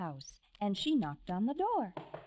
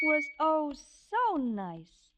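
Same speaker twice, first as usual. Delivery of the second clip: oh lifted high and louder; savoring; 5 semitones higher, 4 dB louder, slower than usual. A narrator's reading. Narrator to a child, dreamy relish. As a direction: relish how nice it is.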